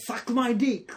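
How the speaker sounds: high, loud, cheeky yell; mock shout. Retelling pranks on the unaware masseuse, mock shout. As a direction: belt it out as a crude joke. Retold crude shout during massage.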